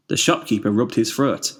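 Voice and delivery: ordinary delivery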